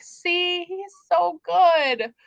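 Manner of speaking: high-pitched, drawn-out gushing; mock gushing fans. Host imitating fans defending Tamlin, mock gushing. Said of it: "people are like".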